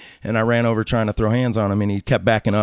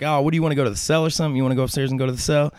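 fast challenge; 5 semitones higher, much faster than usual. Retold or imitated. Daring him to fight.

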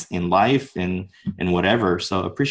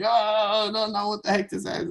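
high, soft mock whimper; near tears; 10 semitones higher, 5 dB quieter than usual. Dancer singled out by yelling teacher, high near-tears whimper. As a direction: imitate panicking at the point of tears. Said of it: "at the point of tears".

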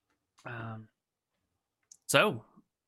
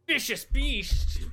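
mock dramatic; 11 semitones higher, much slower than usual. Mock-dramatic label for the cat.

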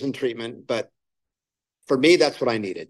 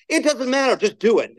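higher-pitched curt command, retold; blunt order; 10 semitones higher than usual. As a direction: imitate the sponsor brushing off the question. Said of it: "That's literally how he talked to me".

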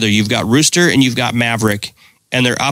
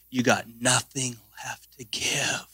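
breathless whisper; 11 dB quieter than usual. Acted. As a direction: whisper it, drained. Sermon climax, whispered.

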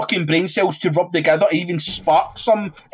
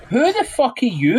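scoffing, higher-pitched sneer; contemptuous retort; 6 semitones higher than usual. Retold or imitated. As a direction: brush him off with scorn. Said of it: "and you're like".

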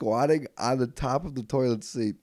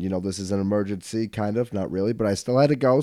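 ordinary talk, nothing marked

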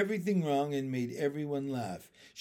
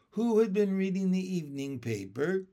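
usual speaking voice

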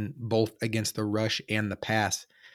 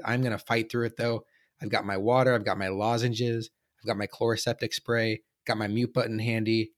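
ordinary talk

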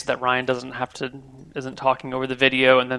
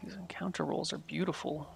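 whispering; 2 semitones higher, 15 dB quieter than usual. Real, not acted. GM's gleeful aside over dice.

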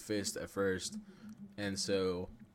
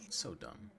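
whispered scoff; 7 dB quieter than usual. Real, not acted. Muttered complaint.

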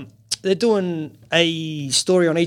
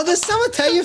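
ordinary talk

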